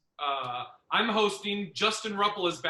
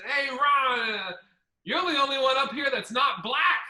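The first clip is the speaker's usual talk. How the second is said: put-on drunk headliner; 6 semitones higher than usual. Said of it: "he goes".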